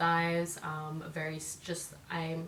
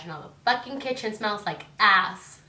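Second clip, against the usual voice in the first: louder, annoyed reading of her text; angry text; 3 semitones higher, 9 dB louder than usual. Retold or imitated. Reading her angry text to husband, annoyed. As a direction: spit out the complaint. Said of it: "So, like, I'll text him".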